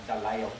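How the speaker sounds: Delisle as "Da-lyle"; correct pronunciation. Shown as a demonstration. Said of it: "Pronounced like that".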